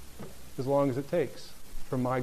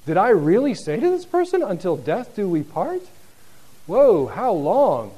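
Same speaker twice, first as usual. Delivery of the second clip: dismayed; 5 semitones higher, 8 dB louder than usual. Retold or imitated. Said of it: "they start saying".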